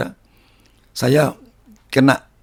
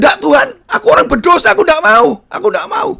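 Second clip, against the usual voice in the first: high-pitched protest to God; retold distressed refusal; 4 dB louder than usual. Sinner retells refusing the Holy Spirit, high distressed protest. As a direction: plead your way out, pitch jumping up.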